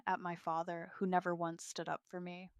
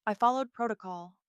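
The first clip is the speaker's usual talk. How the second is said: louder, raised firm defence; firmly; 4 semitones higher, 6 dB louder than usual. A narrator's reading. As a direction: state it without yielding. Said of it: "I say firmly".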